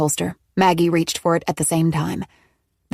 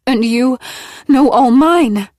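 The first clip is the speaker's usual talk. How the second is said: higher, louder, slow gasping reply; gasped; 6 semitones higher, 7 dB louder, slower than usual. A narrator's reading. Maggie gasps back at Nola in terror. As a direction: gasp it out in fear. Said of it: "Maggie gasped".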